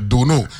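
"Duno" pronounced the Crucian way; Crucian pronunciation. Shown as a demonstration. Teaching a Saint Thomas guest to say "Duno". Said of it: "the pronunciation".

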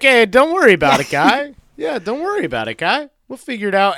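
hammed-up casual Mel Gibson-style reassurance, very fast; mock reassuring dad. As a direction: rattle off easy comfort like a jokey dad. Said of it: "He's like".

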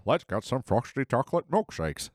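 lightly voiced cartoon dad; a hint of early Homer. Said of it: "season one, Homer".

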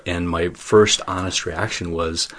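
ordinary delivery

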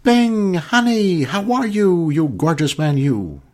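high, loud gushing showbiz agent. Retold or imitated. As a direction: coo over him like a flattering agent. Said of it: "it went a little something like this".

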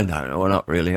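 usual speaking voice

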